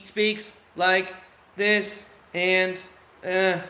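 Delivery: very slow, drawn-out words; imitating slow speech. Father imitating his son's slow talk, drawn out slowly. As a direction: stretch each word out like the son does. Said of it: "doesn't speak like me and you speak, like, at normal speed".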